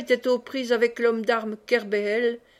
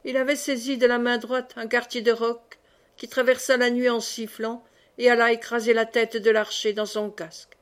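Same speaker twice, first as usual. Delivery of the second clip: plain reading voice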